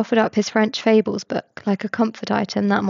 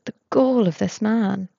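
plain reading voice

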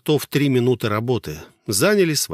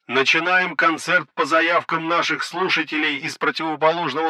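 higher voice through a crackling radio; over walkie talkie; 6 semitones higher than usual. Acted. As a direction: announce it like a hoarse radio broadcast. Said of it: "Рация захрипела голосом коменданта".

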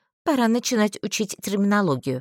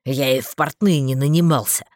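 low-voiced man, a little louder; lightly voiced man; 6 semitones lower, 4 dB louder than usual. A narrator's reading. Narrator voices a male character.

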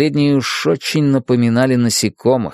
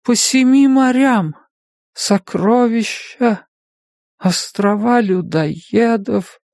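slow, higher, drifting away; dreamy fading voice; 11 semitones higher, slower than usual. A narrator's reading. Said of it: "Голос становился всё тише".

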